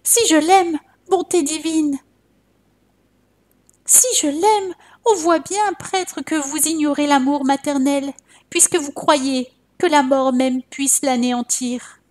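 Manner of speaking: high, distraught protest; mother protests high-pitched to the monk; indignant outburst. A narrator's reading. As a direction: scorn the priest's doubt. Mother indignantly rebukes the priest.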